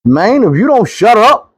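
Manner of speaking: high, rising mock-angry threat; fed-up outburst. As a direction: act out losing patience, pitch jumping up. Snapping at nonstop talker.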